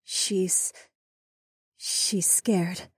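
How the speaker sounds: soft, high, hesitant woman's voice; somber. A narrator's reading. Healer reveals the possessed woman's fear, somber. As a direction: say it gravely and softly, halting. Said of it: "said in a somber tone".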